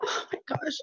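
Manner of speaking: breathless, voiceless gasp on the edge of tears; tearful whisper. Real, not acted. Team member overwhelmed by an aha moment, tearful breathless whisper. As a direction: choke it out, almost crying. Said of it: "I feel like bawling my eyes out".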